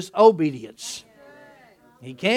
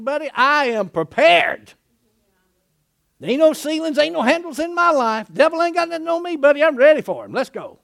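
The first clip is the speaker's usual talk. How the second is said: cocky declaration; 6 semitones higher, 4 dB louder than usual. Acted. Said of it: "He's making a declaration".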